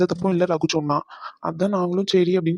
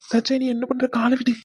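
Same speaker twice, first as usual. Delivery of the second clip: higher-pitched, flustered plea; startled protest; 6 semitones higher than usual. Acted. Husband tells wife to let go of his feet, startled.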